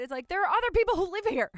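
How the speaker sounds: high, loud, fast exasperated jab; hammed-up fed-up housemate; mock exasperated. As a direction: scold like a fed-up roommate.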